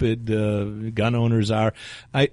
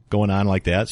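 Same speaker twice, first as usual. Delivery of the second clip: ordinary talk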